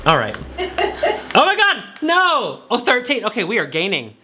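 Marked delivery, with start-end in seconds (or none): none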